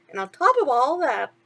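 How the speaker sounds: chirpy sidekick asking what's wrong; a hint of cartoon mouse; lightly voiced mouse. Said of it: "Timothy said".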